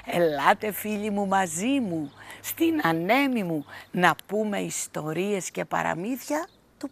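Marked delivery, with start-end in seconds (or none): none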